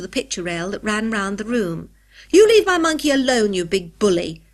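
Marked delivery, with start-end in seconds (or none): 2.3-4.4 put-on loud, high angry child's outburst; furious shout, high start falling away; furious cry. A narrator's reading. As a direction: yell it in outrage at a bully. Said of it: "cried a furious voice".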